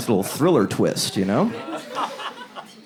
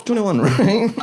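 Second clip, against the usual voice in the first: 1 mocking cashier; 9 semitones higher, slower than usual. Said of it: "crack team".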